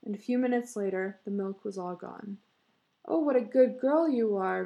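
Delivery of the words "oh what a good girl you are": put-on higher, louder praise to a baby; mother praising her baby girl; fond cry. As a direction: coo proudly at the baby. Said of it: "Missus Taylor cried".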